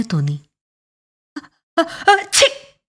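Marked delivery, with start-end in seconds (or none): 1.4-2.8 building high gasps into a sneeze; acted sneeze. Clerk sneezes by accident at the play, loud acted sneeze. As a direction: gasp up, then burst out "chhi". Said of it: "അയാൾ അറിയാതെ തുമ്മിപ്പോയി".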